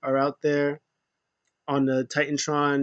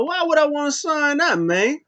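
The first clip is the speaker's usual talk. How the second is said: mock indignant; 12 semitones higher than usual. Bray voiced refusing contract.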